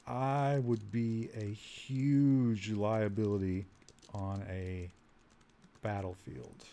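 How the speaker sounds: slow, halting words that drop off softly; slow dictation. Real, not acted. Player slowly typing a reply to the orc captain, slow dictation. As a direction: spell it out word by word as if typing.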